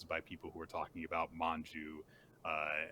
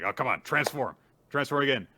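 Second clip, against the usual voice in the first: raised, louder acted command; imitating an impatient order; mild exasperated urging; 7 semitones higher, 9 dB louder than usual. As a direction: push impatiently, pitch lifted. Acts character ordering a transformation.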